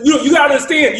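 high and loud, desperate; frantic shout. Retold or imitated. Andy pleading with the call host before being muted, loud desperate shout. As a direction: shout it before they mute him.

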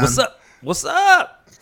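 sudden high shouted what's up; loud greeting. Real, not acted. Caught off guard, loud greeting.

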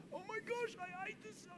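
strained, very soft mock complaint; mock dread. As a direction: squeeze it out small, as if hating every second. Voicing someone hating their calling.

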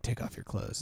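breathy hidden whisper, no voice at all. Retold or imitated. Aladdin murmuring a hidden line to Jasmine, whispered. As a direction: slip it in under your breath. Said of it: "he, like, whispers".

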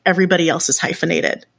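usual speaking voice

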